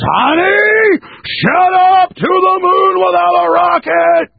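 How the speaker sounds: very high, drawn-out sitcom husband yell; Ralph Cramden yelling. Retold or imitated. Bus driver to his wife on the Honeymooners, yelling. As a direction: holler it like a blustering TV husband. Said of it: "yells at his wife and says".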